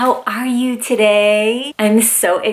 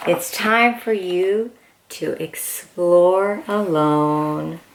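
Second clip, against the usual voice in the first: storybook reading; 3 semitones lower than usual. Acted. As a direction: read it gently like bedtime to a toddler. Reads picture book aloud.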